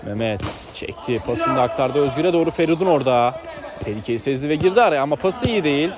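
ordinary talk

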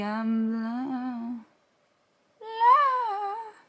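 high, wailing mock despair; dramatic whine. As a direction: overplay being blind. Dramatic whine about not seeing.

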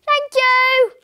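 high, loud singing-out reply; crying back. A narrator's reading. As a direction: call back high to someone in another room. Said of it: "cried Missus Twiddle back".

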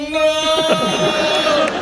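high, long-held no; slow-motion no. Retold or imitated. He joins the cry.

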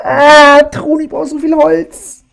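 high, slow whining; whiny complaint. Acted. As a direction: whine it like a needy kid. Whining to teammate about wood.

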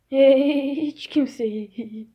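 normal talking voice

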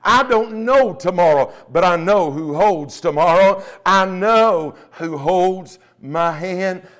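ordinary talk, nothing marked

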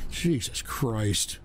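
low, slow and soft; sigh, then drop very low; drained, hopeless exhale; weary sigh. Real, not acted. Exhausted by trolls.

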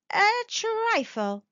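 high, drawn-out, evasive answer; slightly embarrassed. A narrator's reading. Husband admitting he paid, a little embarrassed. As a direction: stretch it out sheepishly. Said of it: "colouring slightly".

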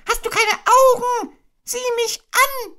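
a desperate little pig; frantic Piglet. Acted. Piglet begging Kanga to look at him, frantic.

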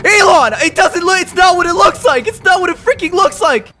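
high, frantic pleading; panicked excuses. Real, not acted. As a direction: plead loudly on being caught red-handed. Caught by Elon stealing cars.

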